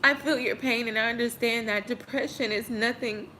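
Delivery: holding back tears while reassuring viewers; getting emotional. Real, not acted. Vlogger tearing up over viewers' messages about depression, choked up. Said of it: "it just makes me emotional".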